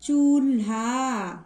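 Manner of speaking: चूल्हा drawn out, both long vowels held; demonstrated pronunciation. Shown as a demonstration. Teacher showing how to read vowel signs, slow and drawn out. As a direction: stretch चूल्हा slowly, syllable by syllable. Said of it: "इस तरीके से".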